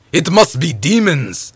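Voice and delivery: slow, loud, high exclamation; alarmed cry. Acted. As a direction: cry out the dreadful news, drawn out. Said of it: "cried one man".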